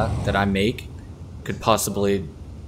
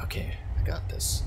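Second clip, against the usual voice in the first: whispering; 8 dB quieter than usual. Real, not acted. Steadying himself before a jump.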